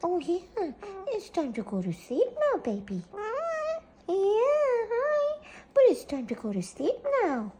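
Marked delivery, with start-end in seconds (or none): none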